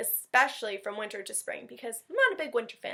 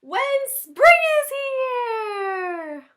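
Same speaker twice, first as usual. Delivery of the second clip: much higher, much louder, very slow cry; big excited exclamation; 13 semitones higher, 13 dB louder, much slower than usual. Acted. Teacher belting the spring line for children, much higher and louder. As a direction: stretch it out joyfully.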